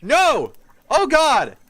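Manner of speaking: sudden loud shocked cry; alarmed cry. Real, not acted. Player startled by the hag's words, loud shocked cry. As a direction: yelp in real alarm. Player reacting loudly to game.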